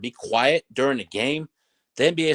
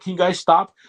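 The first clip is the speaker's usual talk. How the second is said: higher and louder, whiny request; mock pleading referee; 7 semitones higher, 5 dB louder than usual. Referee asking players to stop trash talking, high whiny plea. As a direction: beg the players like a tired ref. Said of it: "the referee came in and said".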